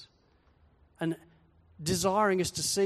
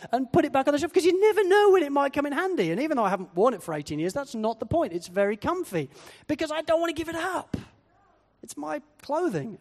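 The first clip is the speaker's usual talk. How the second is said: put-on childish defiance; 7 semitones higher, 4 dB louder than usual. Stubbornly rescuing old jumper.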